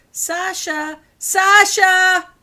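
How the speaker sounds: high call building to a very loud shout; calling, then shouting. A narrator's reading. Calling the missing cat again.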